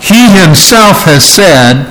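"He himself" stretched out slowly; emphatic. Shown as a demonstration. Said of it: "Very emphatic".

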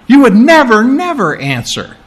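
"never" struck high, the second one falling off; stress on the two nevers. Shown as a demonstration. Preacher repeating Jesus, stressing "never, never". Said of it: "two nevers".

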